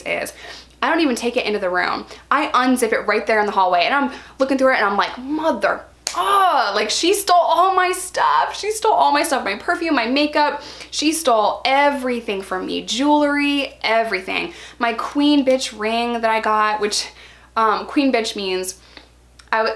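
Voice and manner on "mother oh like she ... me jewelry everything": outraged discovery. Retold or imitated. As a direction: cry out that she took it all. Discovering stolen stuff, upset.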